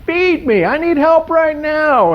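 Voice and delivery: high, slow, pleading screech; screaming monkey. Acted. Neglected monkey shrieking at the tour group for food. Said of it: "monkeys screaming up against the glass".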